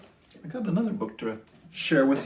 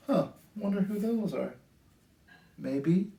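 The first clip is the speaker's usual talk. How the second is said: usual speaking voice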